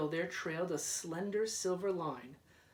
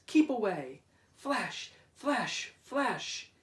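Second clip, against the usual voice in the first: loud, higher urgent warning; lighthouse warning; 4 semitones higher, 4 dB louder, slower than usual. Acted. Lighthouse signalling passing boats, loud urgent call. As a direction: call the warning across the water. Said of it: "spoke out plainly".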